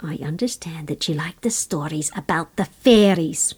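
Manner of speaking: put-on soft whispered old Irish woman; lilting irish storyteller. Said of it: "And she said".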